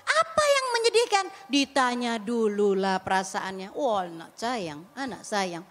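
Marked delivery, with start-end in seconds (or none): 3.7-5.7 soft, low and slow soothing; tender comfort. Pretend. Mother gently asking her crying child why he is sad, tender and slow. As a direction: coax the child gently, slowing down.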